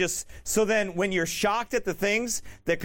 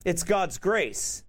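ordinary talk, nothing marked

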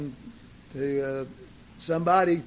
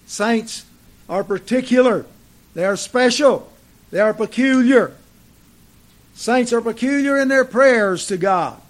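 loud, higher, ringing declarations from the pulpit; emphatic preaching; 6 semitones higher, 9 dB louder, slower than usual. Real, not acted. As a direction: proclaim it forcefully, drawing out the key phrases. Preaches at raised force.

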